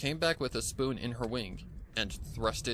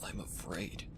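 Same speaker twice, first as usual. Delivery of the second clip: afraid; 4 semitones lower, 6 dB quieter, slower than usual. Acted. Starving stallion admits fear.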